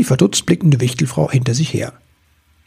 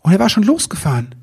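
hectic; 8 semitones higher, faster than usual. A narrator's reading. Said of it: "blickte sich hektisch um".

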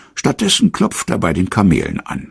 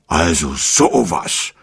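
indignant; slower than usual. A narrator's reading. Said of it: "sagt Benjamin empört".

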